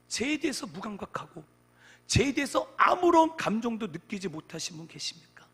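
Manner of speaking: impassioned sermon delivery; forceful preaching. Real, not acted. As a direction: press the question loudly on the congregation.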